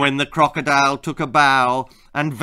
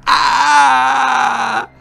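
high loud bratty scream; full-voiced tantrum shriek; spoiled shriek; 7 semitones higher, 8 dB louder than usual. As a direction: shriek at the top of her voice. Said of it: "at the top of her voice".

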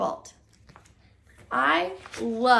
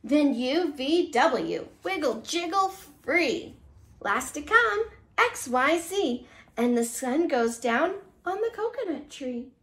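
lively storybook read-aloud; reading to children; 2 semitones higher than usual. Acted. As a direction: read it like a bedtime picture book. Reads picture book to children.

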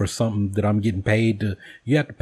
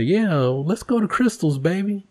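teasing sweet talk; 9 semitones higher than usual. Retold or imitated.

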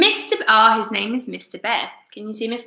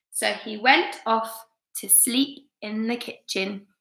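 plain reading voice

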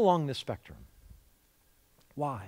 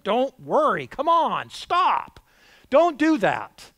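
exasperated angry scolding, higher and louder; 5 semitones higher, 5 dB louder, slower than usual. Shown as a demonstration. Preacher voices Jesus misheard as an angry person, angry. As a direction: snap it like a fed-up, angry person. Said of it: "You can hear it like an angry person".

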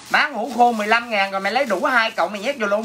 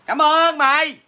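higher, louder gushing thank-you; over-the-top thanks; 6 semitones higher, 4 dB louder, slower than usual. Shown as a demonstration. Seller loudly thanking buyer Xuyên Lê, gushing. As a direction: shout your thanks like a hyped seller. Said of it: "Đó là phong cách của em".

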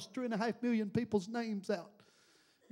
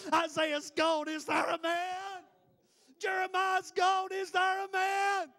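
slow, higher ringing call; preaching cry; 5 semitones higher than usual. Acted. Preacher calling for someone to answer God, ringing preaching cry. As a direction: call out across the sanctuary.

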